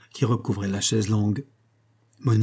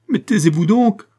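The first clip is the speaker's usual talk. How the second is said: very high, loud, frantic outburst; panicked cry; 11 semitones higher, 8 dB louder than usual. A narrator's reading. Maddened Monique cuts off madame's accusations, panicked cry. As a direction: cry out wildly to silence her. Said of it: "cria Monique, affolée".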